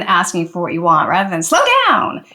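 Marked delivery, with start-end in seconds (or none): 1.4-2.2 sharp, much higher, curt order; blunt command. Shown as a demonstration. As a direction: snap it out like a complaint. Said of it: "it's all about the tone".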